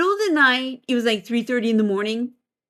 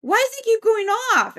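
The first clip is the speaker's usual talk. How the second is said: high, complaining imitation; puzzled Barry; 11 semitones higher than usual. Housemate asks about the werewolf going off, high bewildered voice. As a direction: mimic a bewildered housemate, pitched up. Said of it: "He goes".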